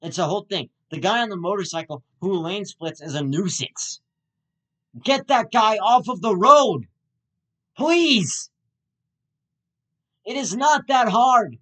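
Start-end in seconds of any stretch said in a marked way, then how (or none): 5.0-11.6 much higher, louder shouted rant; furious yell. Acted. Yelling at a lane-splitting biker, furious shout.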